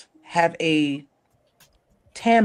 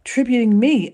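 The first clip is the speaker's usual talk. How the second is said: lightly voiced [name], higher and moved; a touch of [name]; 7 semitones higher than usual. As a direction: quote her, higher, almost tearful. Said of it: "she was like".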